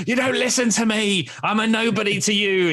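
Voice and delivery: high, hammed-up wounded wail; fragile confidence. Ignored dad complaining to his kids, mock wounded. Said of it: "my confidence is so fragile".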